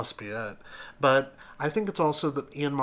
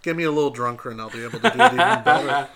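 laughing; 3 semitones higher, 10 dB louder, faster than usual. Real, not acted. Laughing at own accent attempt.

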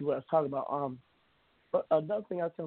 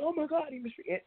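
much higher, imitating a gasping busybody; mock shocked neighbors; 12 semitones higher than usual. Neighbors gasping over his dog, in a mock high shocked voice. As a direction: toss it up high like a scandalised neighbour. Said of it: "people are like".

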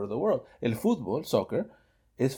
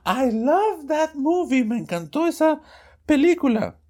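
first of two voices; 13 semitones higher, 4 dB louder than usual. Acted. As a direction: voice the first person in the dialogue, pitched high. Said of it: "la conversación con dos voces".

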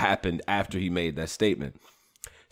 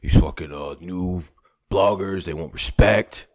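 low, halting mock of a battler; Mike Polisi; 5 semitones lower than usual. Host quoting gripe about new bloggers, lightly imitated low voice. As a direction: lightly imitate his grumble, pitched low. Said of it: "I read it in his voice".

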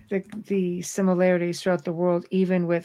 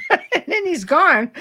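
laughing; 8 semitones higher, 5 dB louder than usual. Real, not acted. Caller dropped off, amused.

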